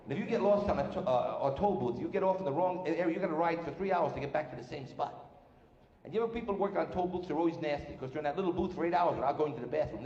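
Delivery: ordinary delivery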